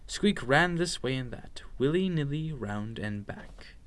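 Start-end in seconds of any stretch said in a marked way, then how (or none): none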